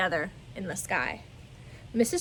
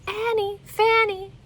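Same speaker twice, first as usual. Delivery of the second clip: echo calling back; 12 semitones higher, 6 dB louder, slower than usual. Acted. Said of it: "the echo called back".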